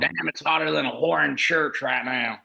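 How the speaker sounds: hammed-up Southern twang; imitated Southern accent. Imitating his Southern buddy complaining, Southern accent. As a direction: mimic a Southern buddy griping about the heat. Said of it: "oh, he's Southern".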